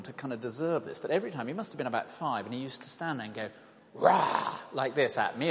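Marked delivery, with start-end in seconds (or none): 3.9-4.6 a small boy's breathy pretend roar, pitched up; child's playful lion roar, lightly imitated; mock lion roar. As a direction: growl it out like a five-year-old playing lion. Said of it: "as if he was a lion".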